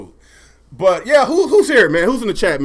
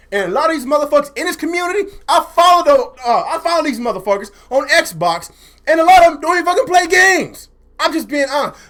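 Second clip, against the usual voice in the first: high, heated complaint building louder; heated rant; 9 semitones higher, 4 dB louder than usual. Real, not acted. Streamer calling out his gaming community, heated. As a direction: build to an exasperated, raised-voice finish. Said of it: "I'm a little heated".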